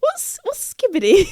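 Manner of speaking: high put-on dad voice asking; imitating dad. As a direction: mimic a puzzled dad, pitched high. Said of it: "He's like".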